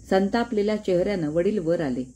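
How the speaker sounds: ordinary delivery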